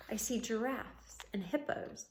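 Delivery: plain reading voice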